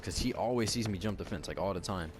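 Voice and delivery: usual speaking voice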